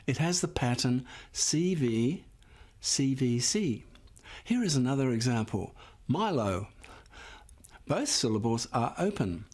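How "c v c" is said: ordinary delivery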